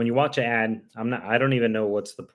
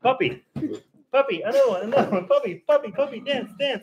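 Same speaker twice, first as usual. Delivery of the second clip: much higher excited puppy voice; high puppy talk; 12 semitones higher, 6 dB louder than usual. Real, not acted. Owner cheering her puppies to dance, high and excited. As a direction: chirp it up high for the dogs.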